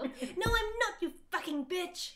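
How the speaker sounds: hammed-up furious yell, high and loud; angry shout. A narrator's reading. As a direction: shout it like a melodramatic teen. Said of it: "he shouted angrily".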